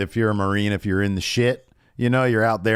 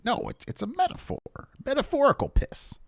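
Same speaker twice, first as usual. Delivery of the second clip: much higher, squeaky defensive explanation; squeaky mock Heyman; 4 dB quieter than usual. Host imitating Heyman explaining the metaphor to Roman, squeaky and flustered. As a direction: pipe up shrilly, flustered.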